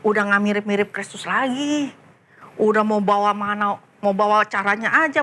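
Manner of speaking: plain reading voice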